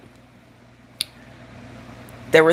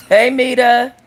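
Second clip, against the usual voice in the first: raised, higher-pitched call; 6 semitones higher, 4 dB louder, slower than usual. Real, not acted. Comic calls to a listener, calling out. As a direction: call her name across to her.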